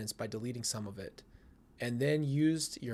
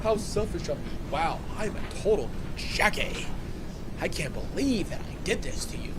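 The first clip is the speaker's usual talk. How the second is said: mocking; 4 semitones higher than usual. Mocking the texter's apology.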